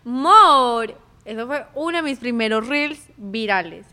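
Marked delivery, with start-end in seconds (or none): 0.0-0.9 put-on loud, drawn-out kid's call; imitating a kid. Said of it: "una vez un niño dijo". (imitando a un niño, fuerte)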